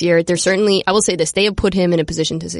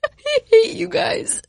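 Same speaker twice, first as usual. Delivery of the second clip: high, drawn-out words through laughter; laughing protest; much slower than usual. Real, not acted. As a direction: protest helplessly while laughing. Host laughing at prank.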